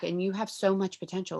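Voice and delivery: ordinary talk, nothing marked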